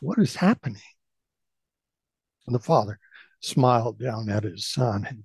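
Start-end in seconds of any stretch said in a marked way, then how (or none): 0.0-0.8 put-on higher puzzled boy's question. A narrator's reading. Son looks to his father, puzzled question. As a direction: ask it as a baffled child. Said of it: "puzzled, and asked".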